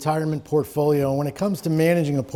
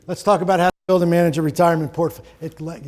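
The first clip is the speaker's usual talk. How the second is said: normal talking voice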